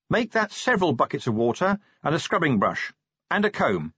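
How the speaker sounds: businesslike officer giving instructions; commanding Angua. Acted.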